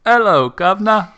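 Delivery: hammed-up British accent, high and loud; playful cockney accent with a high bright pitch; mock cockney greeting. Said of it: "every time I try to do an accent".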